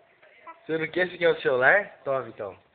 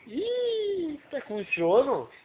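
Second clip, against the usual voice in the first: put-on baby talk; 9 semitones higher, much slower than usual. Parent cooing to sleepy baby.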